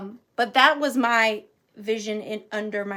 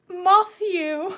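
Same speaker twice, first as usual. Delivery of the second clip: put-on high little-boy English voice; pitched-up mimicked child; giggling little boy; 7 semitones higher, 4 dB louder than usual. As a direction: imitate a small boy about to giggle. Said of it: "that little boy who's giggling".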